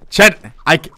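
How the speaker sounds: ordinary talk, nothing marked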